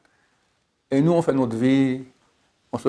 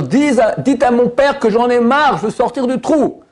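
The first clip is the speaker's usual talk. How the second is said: fed-up son; 10 semitones higher, 4 dB louder than usual. Acted. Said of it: "demande au serviteur".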